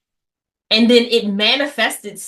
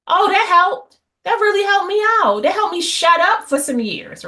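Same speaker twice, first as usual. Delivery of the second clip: sarcastic; 8 semitones higher than usual. Acted. Sarcastic about being told to be strong.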